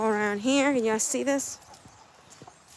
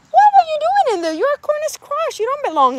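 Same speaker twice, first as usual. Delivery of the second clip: much higher, loud and fast; shocked scolding; 8 dB louder than usual. Real, not acted. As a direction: squeal it out in shock at the hen. Scolding a hen in the corn.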